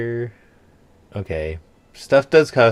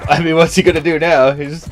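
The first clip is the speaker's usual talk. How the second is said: laughing; 9 semitones higher, 4 dB louder than usual. Real, not acted. Laughing at the enemy's plight.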